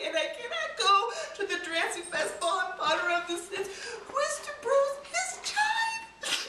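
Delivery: stammering, laughing fake sob; hammed-up weepy girl stuttering; sobbing Cinderella. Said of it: "And Prinderella said".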